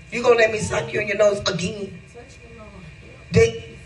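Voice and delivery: normal talking voice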